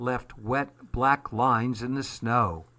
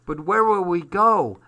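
high, louder anxious question; worried child; 7 semitones higher, 7 dB louder than usual. A narrator's reading. Susie asking Armand where they will go, worried. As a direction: pipe up like an anxious little girl.

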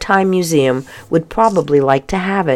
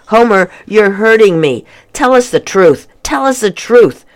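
insisting; 7 semitones higher, 5 dB louder than usual. Acted. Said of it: "Homer insisted".

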